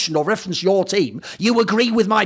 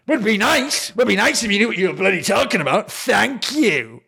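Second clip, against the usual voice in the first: mock huffy; 3 semitones higher than usual. Host mimicking the salty manager's reply, mock huffy. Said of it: "you're even doing this, like, mad feminine coded thing".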